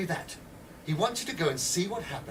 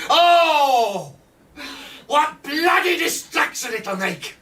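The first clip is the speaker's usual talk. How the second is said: much higher, loud gloating cry; gleeful shout; 9 semitones higher, 9 dB louder, much slower than usual. Acted. Edwards gloating over the bomb plan, a loud gleeful shout. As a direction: crow with delight over the chaos.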